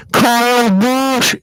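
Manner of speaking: loud, high, stretched-out yell; imitated kid's scream. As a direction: yell it like an overexcited little kid. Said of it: "and he's like".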